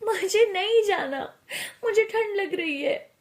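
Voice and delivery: high-pitched acted whimpering; tearful protest. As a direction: whine as if about to cry from cold. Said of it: "रूहानी की आंखों में आंसू आ जाते हैं".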